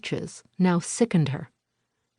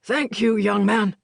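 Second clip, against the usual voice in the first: lightly voiced gruff old man; a hint of a muffled old neighbor; 4 semitones higher, 3 dB louder than usual. A narrator's reading. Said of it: "said the gruff, muffled voice".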